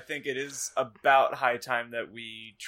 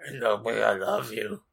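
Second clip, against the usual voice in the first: lower, put-on mournful voice, no real shout; sad lover; 5 semitones lower than usual. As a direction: play the heartbroken boy, understated. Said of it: "Draco shouted sadly".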